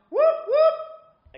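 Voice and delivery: very high, loud whooping cry; whoop shout. Shown as a demonstration. Vicar showing the children what to shout, a loud high whoop. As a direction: whoop it out like a cheer. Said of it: "then I want you to shout".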